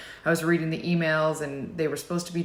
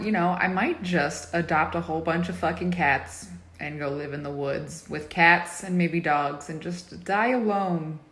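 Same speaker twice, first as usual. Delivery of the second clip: ordinary talk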